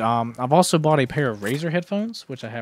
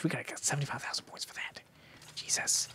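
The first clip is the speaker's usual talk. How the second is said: whisper; 15 dB quieter, faster than usual. Real, not acted. Awed at points cost.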